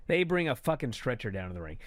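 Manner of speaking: usual speaking voice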